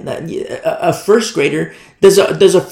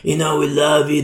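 rock star voice; 3 semitones lower, 4 dB quieter than usual. Retold or imitated. Worship leader greets the church, rock star voice. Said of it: "his rock star voice".

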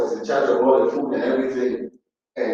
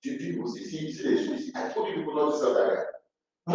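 much softer, hushed reproach; hushed among themselves; 9 dB quieter, faster than usual. Acted. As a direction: mutter it so the others can't hear. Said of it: "talking among themselves".

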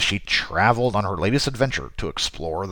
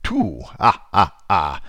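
vampire count voice; 4 dB louder than usual. Acted. Counting vampire facts, Count style.

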